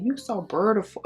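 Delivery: a hint of backwoods Mississippi; country Mississippi accent. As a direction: mimic his rural Mississippi accent. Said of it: "deep off in the country from Mississippi".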